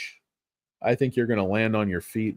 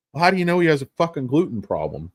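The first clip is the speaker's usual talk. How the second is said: ordinary delivery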